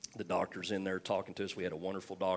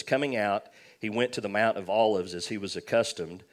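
scripture reading; 7 dB louder than usual. Acted. Reads scripture aloud.

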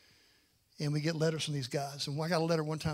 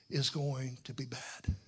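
grave hush; 5 semitones lower, slower than usual. Real, not acted. Solemn warning about hell.

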